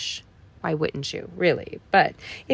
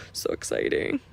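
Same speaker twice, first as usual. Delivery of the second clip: excited whisper; 6 dB quieter than usual. Real, not acted. Gasps over new dishwasher.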